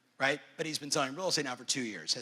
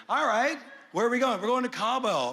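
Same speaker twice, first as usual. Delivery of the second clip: higher, louder, eager trip talk; mock-excited plans for Cabo; hammed-up buddy bragging about the trip; 7 semitones higher, 4 dB louder than usual. Retold phone chat.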